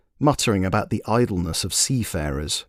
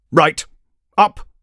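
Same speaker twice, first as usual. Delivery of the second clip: louder, higher royal command; 7 semitones higher, 7 dB louder than usual. Acted. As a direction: order them to their feet. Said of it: "commanded the king".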